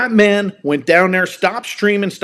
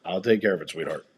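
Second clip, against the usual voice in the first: soft reassurance; 9 semitones lower, 9 dB quieter, faster than usual. Real, not acted. Reassures child interrupting rant.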